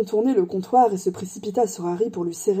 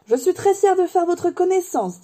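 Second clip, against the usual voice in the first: high-pitched character voice, full of pride; gushing admirer; 10 semitones higher than usual. Acted. Bar customer shaking Harry's hand, high gushing pride. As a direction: gush in a much higher voice.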